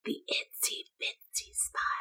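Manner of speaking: breathy whispered singsong. Shown as a demonstration. Teacher whispering the spider song to children. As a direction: whisper it so only the front row hears. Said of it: "a whisper voice".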